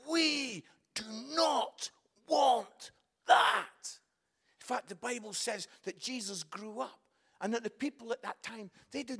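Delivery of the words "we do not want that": slow, loud, each word separate; the world refusing. Retold or imitated. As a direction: echo a child's stubborn wail. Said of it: "the world said".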